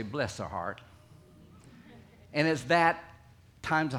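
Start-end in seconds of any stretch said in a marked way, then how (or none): 0.0-0.8 Southern saying. Retold or imitated. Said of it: "we Southerners often say".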